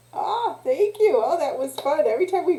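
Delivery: bubbly, pleased exclamation; delighted thanks. Real, not acted. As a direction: gush after a fun game. Delighted after the breathing game.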